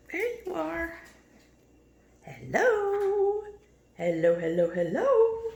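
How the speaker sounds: high, loud greeting; high sing-song hello. Real, not acted. Owner greeting her parrot, high sing-song hello.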